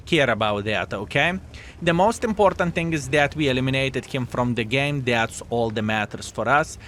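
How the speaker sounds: ordinary delivery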